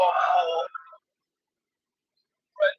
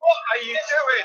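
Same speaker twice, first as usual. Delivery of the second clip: disbelieving shout; 8 semitones higher than usual. Real, not acted. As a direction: yell at the player in disbelief. Baffled by the missed penalty.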